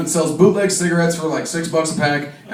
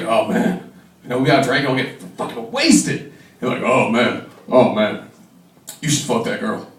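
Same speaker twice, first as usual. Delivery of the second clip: lowered Patrick voice; 7 semitones lower, 3 dB quieter than usual. Retold or imitated. Voices Patrick egging him on.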